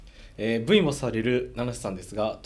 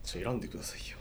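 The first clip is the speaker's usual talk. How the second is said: whisper; 10 dB quieter, much faster than usual. Real, not acted. Whispered request to co-host.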